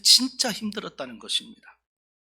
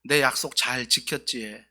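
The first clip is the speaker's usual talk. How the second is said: attempted Busan dialect; 2 semitones lower than usual. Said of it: "여기 부산 사투리로 해야 되는데 잘 안 돼요".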